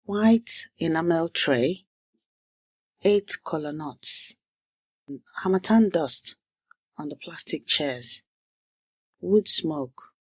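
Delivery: measured, dramatic storytelling voice; story narration. A narrator's reading. Language teacher opening the lesson with a story, narrated dramatically.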